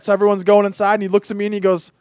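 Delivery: ordinary delivery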